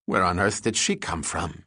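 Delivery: ordinary delivery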